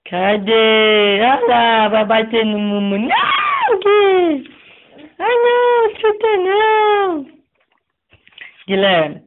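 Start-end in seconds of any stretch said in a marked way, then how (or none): 3.0-7.3 sudden high yelp of alarm; high alarmed cry. Real, not acted. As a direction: squeal to stop the kick. Baby about to kick brother. (grito agudo de alarme)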